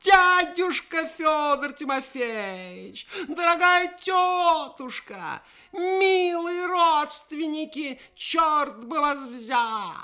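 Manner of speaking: very high, slow clown patter; loud showman shouting over a crowd; booming circus ringmaster. Acted. Clown greets his pets in arena.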